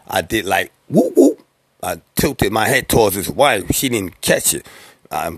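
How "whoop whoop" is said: much higher, sharp whoop-whoop call; signal whoop. Retold or imitated. Signalling Coco with a nod toward his wife, high whoop call. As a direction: toss out a quick secret signal call.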